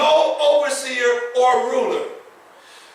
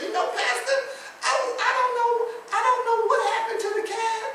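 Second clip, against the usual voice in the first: put-on high, soft old-lady voice; old church lady; 11 semitones higher, 6 dB quieter than usual. Old sister calls the pastor about her cat, high and soft. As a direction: play a sweet, puzzled elderly sister. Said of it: "she calls and says".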